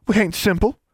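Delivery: high-pitched, offended huff; indignant huff. A narrator's reading. Constance bristles at Alexandra, huffy. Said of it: "Constance huffed".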